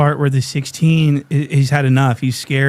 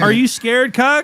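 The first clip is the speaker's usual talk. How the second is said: much higher mock taunting voice; 10 semitones higher than usual. Imitating Lush taunting the scared guy. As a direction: taunt him in a squeaky voice. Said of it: "acted taunt".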